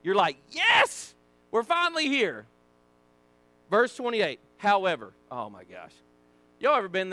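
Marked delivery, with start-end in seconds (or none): 0.5-2.5 high, loud burst of relief; thrilled, high-pitched yell; excited cheer. Acted. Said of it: "Now you're excited". 5.3-5.9 low, soft deflated groan of words; quietly crushed, dropping low; acted letdown. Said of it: "It's that letdown".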